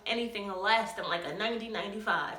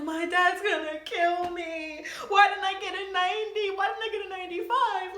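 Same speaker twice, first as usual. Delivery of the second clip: mock wailing; 9 semitones higher than usual. Teen crying over report card, mock wailing high. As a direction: wail it like a hammed-up meltdown. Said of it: "I'm like".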